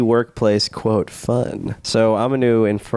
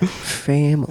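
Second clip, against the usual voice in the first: mobster voice; 3 semitones higher, slower than usual. Acted. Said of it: "say with me".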